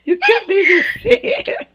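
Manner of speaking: flattered laughing repeat, way up high; squealing laugh. Real, not acted. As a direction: break into a high giggle as you repeat it. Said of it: "I'm too dark to blush".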